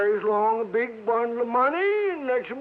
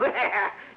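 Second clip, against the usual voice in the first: put-on a wheezy old-timer cackle; old man's cackle; 3 semitones lower than usual. Sidewinder cackles at Johnny's complaint, wheezing laugh.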